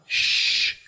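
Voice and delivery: long hushing "sh,"; sh sound. Shown as a demonstration. Teacher demonstrating a phonetic symbol, "sh," as a long hush. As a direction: say "sh," as a drawn-out, voiceless hush. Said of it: "El símbolo ipa".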